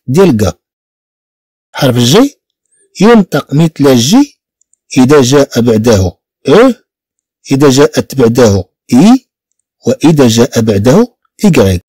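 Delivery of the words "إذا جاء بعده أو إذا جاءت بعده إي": ordinary talk, nothing marked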